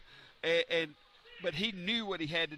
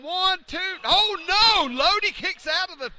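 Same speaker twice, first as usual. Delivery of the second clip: excited shout; 11 semitones higher, 11 dB louder than usual. Real, not acted. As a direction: count screamed, much higher and louder. Counting pin, kick-out.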